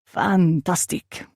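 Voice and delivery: slow, drawn-out bad French accent; terrible French accent. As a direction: ham up a French accent, stretching the word. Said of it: "he said in a terrible French accent".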